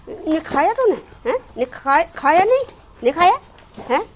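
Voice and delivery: loud, high nagging rebuke; scolding. Real, not acted. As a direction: keep pressing the dog with angry questions. Scolding the dog again.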